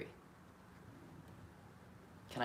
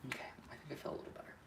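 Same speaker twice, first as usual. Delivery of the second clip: whisper; 12 dB quieter, much faster than usual. Real, not acted. Relieved aside to herself.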